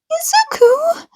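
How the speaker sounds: high, alarmed cry of his name; worried call. Acted. Mother staring at the burnt notebook, alarmed high call.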